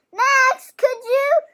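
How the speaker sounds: high, loud calling voice; little girl calling. A narrator's reading. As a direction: call out brightly to Max. Irene calls to Max.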